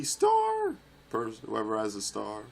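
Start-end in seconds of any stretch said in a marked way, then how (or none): 0.0-0.7 high, drawn-out yell; startled shout. Real, not acted. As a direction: yell it out in high-pitched alarm. Calling out at attacking player.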